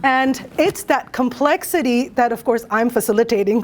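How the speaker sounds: ordinary talk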